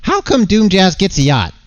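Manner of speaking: high-pitched cartoon complainer; whining yacht-envious character. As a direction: squeal it like an indignant cartoon character. Voices a yacht-obsessed game character.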